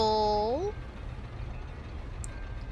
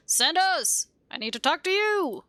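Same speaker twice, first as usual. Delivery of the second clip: raised, projected call; 7 semitones higher than usual. Acted. Visitor calls to the man locked behind the door. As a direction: call through a closed door, pitched up. Calling through a closed door.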